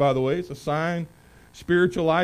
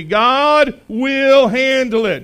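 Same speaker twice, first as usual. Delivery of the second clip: loud, slow, every word weighted; emphatic declaration; 7 semitones higher, 9 dB louder, much slower than usual. Shown as a demonstration. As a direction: proclaim it for the church to echo. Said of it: "Say it back to me".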